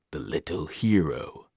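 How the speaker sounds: slow, low and amused from the depths; an ancient evil savoring the words. Acted. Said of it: "an amused voice echoed down in the darkness".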